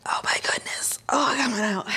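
gasping whisper of effort; breathless whisper. Real, not acted. Coach finishing a hard set, whispering breathlessly. Straining through exercise.

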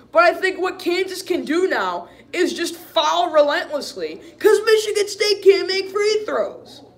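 high-pitched, heated complaint; exasperated rant. Real, not acted. Fan on his team's bad free throws, exasperated.

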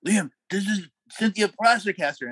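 high-pitched put-on introduction voice. Musician introducing [name] to his brother, imitated voice. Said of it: "[name]'s like".

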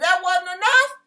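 a hint of God scolding David; rebuking God. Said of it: "And the Lord said".

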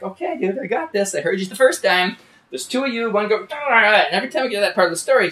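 exasperated insistence. Retold or imitated. Retelling arguing with irritated tribesman.

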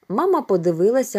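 ordinary delivery

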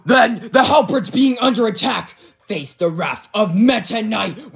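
high, loud war cry; battle shout. Acted. Knight's ship under attack, shouting a challenge.

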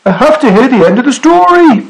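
high, urgent storybook shout. A narrator's reading. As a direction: beg a departing sailor to stop. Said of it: "he shouted".

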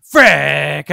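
sudden loud burst of frustration; frustrated shout. Real, not acted. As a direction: yell it out, annoyed at yourself. Frustrated outburst at wasting iron.

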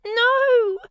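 high, loud scream of no; high frightened shout; witch's cry. A narrator's reading. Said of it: "cried the witch".

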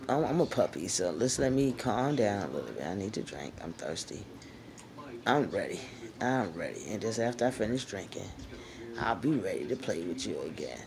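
low, soft tired dog voice; Guns' voice. Pretend. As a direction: voice the thirsty little dog begging for a break. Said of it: "Guns said".

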